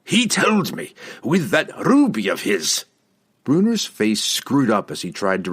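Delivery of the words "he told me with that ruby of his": high-pitched, emphatic dwarf voice; heated dwarf declaration; insistent dwarf. A narrator's reading. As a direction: insist hotly on the memory. Bruner insists after growling.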